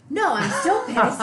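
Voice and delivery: high, loud and slow, laughing through a mock sulk; sulky athlete answers coach, laughing; laughing pout. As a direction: play up the pouting with a laugh. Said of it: "you done pouting".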